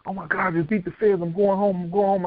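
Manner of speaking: high, overjoyed exclaiming; exclaiming on the Greyhound bus, overjoyed and high; retold elation. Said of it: "jumped up and shot".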